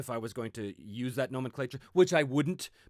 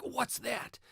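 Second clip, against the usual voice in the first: high-pitched, mock-intrigued question; acted curious wonder; 12 semitones higher than usual. Players spotting three dots on the map, acted curious wonder.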